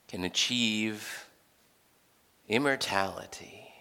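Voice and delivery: low, hushed temptation with long pauses; tempting serpent. Acted. Talking snake tempting with promises in Genesis, low and hushed. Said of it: "a talking snake who says".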